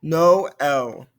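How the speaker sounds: NO L pulled into two clear syllables, "no - EL"; separate vowel sounds. Shown as a demonstration. Teacher showing the different sounds in Noël, separate vowels. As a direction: sound the O and the E of NO L apart. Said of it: "Different sounds there".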